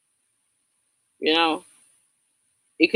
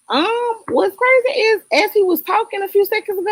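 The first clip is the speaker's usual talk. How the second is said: excited; 3 dB louder than usual. Real, not acted. Excited realisation.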